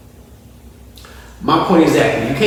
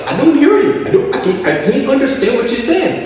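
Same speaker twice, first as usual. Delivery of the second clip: confused Giannis; 7 semitones higher, faster than usual. Retold or imitated. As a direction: strain to hear in the huddle. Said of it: "Giannis sitting there in the huddle like".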